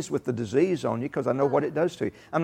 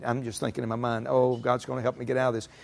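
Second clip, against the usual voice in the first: softer, lower, as a private thought; thinking to himself; 4 semitones lower, 5 dB quieter than usual. Acted. Believer reassuring himself in his head, softly thinking to himself. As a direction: say it inwardly, under the preaching level. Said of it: "You're thinking".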